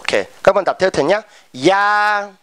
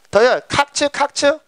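higher-pitched pupil insisting on a difference; protesting student; 8 semitones higher than usual. Acted. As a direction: object to the teacher as a student. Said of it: "các bạn ý đang thắc mắc ý".

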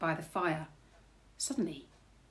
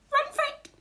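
put-on very high, loud baby squeak; piercing baby copying a phrase; 5 dB louder, faster than usual. A narrator's reading. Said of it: "the baby says".

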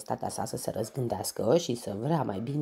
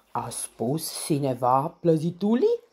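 a lisping lion voice, louder; thick lisping voice; 4 semitones higher, 6 dB louder than usual. A narrator's reading. Lion calling down from the tree about cakes, lisping. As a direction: lisp it like a greedy lion king. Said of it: "o voce groasă și peltică".

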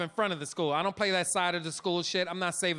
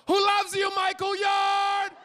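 high, loud call with the name stretched out slow; loud high shout, drawn-out Michael Yard; yelled out the window; 11 semitones higher, 4 dB louder, much slower than usual. Retold or imitated. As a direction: embarrass your son from the car. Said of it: "I just yelled out the car window".

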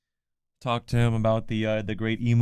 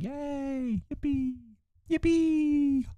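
mock cheer; 4 dB quieter, much slower than usual. Mock celebrating stream switch.

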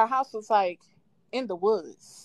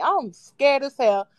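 ordinary delivery

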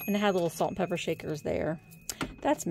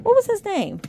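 excited plea; 13 semitones higher, 9 dB louder than usual. Real, not acted. Trying to recall name.

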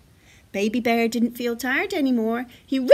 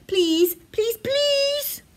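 high pleading, rising to squeaky; begging; 11 semitones higher, 4 dB louder, much slower than usual. A narrator's reading. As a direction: plead ever higher like a small child. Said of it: "begged Baby Bear".

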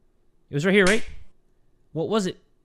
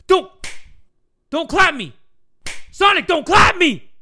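rising panicked yell, louder and higher; 11 semitones higher, 6 dB louder than usual. Real, not acted. Panicking at the chasing enemy.

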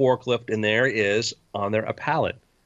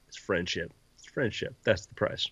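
hushed; 6 semitones lower, 7 dB quieter than usual. Acted. Shopkeeper whispers price is friendship.

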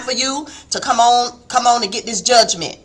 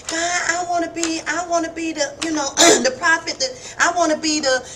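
higher, softer wishful voice; mock-eager asking, like a child begging; lightly imitating her old prayers; pleading with God; 5 semitones higher, 5 dB quieter than usual. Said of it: "I used to be going to God".

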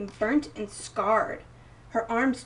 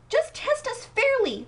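much higher, slow, pleading protest; indignant exclaim; 13 semitones higher, 4 dB louder than usual. Acted. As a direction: insist they deserve a fair test. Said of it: "they exclaimed".

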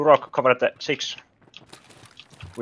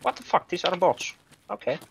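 surprised; 7 semitones higher, 4 dB quieter than usual. Real, not acted. Sudden enemy sighting.

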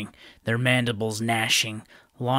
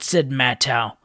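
usual speaking voice

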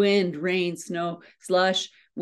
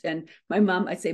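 ordinary delivery

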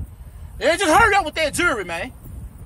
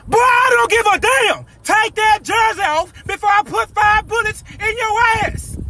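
high, slow, shouted threat; furious shout; 9 semitones higher, slower than usual. Acted. Robber demands the jersey, slow high furious shout. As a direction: spit each word out slowly at him.

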